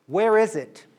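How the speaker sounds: retold assistant snapping where is it; sharp demand.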